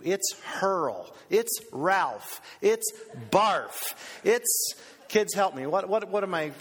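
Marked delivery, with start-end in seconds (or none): none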